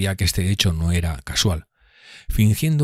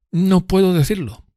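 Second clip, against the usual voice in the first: high, affected evasive reply; voicing Drumley's evasive reply; 12 semitones higher than usual. A narrator's reading. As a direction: stonewall him. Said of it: "respondió".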